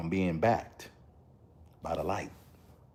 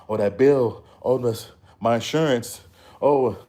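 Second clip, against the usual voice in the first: imitating a broke person fretting over bills, louder; mock worried groan; 4 semitones higher, 7 dB louder than usual. Talking to viewers, mimicking regular folk stressed about bills, mock worried groans. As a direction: sigh it out like someone drowning in bills.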